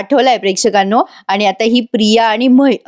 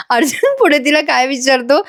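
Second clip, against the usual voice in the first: ordinary talk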